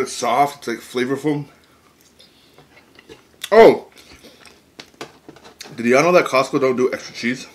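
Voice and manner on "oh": sudden high loud gasp of surprise; startled outburst; surprised. Real, not acted. Sudden exclamation while eating.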